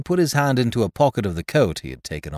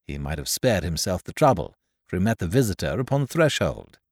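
ordinary delivery, nothing marked

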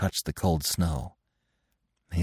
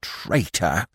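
lightly voiced squirrel cry; small animal's accusation; shrill squirrel. A narrator's reading. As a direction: cry out with a questioning lift. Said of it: "cried the squirrel shrilly".

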